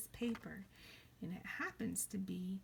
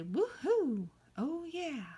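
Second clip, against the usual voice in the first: high, loud whoop; excited cheer; 8 semitones higher, 7 dB louder, slower than usual. Shown as a demonstration. Teacher models exciting words, excited cheer. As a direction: whoop it up for the children. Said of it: "Can you say something exciting?".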